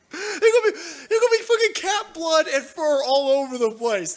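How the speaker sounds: high, loud comic outburst; half-laughing, high-pitched exasperation; worked-up rant. Real, not acted. Ranting about cats fighting outside.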